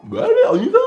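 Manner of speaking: eager "let's go"; excited agreement. Retold or imitated. Friends jumping at the after-party, excited.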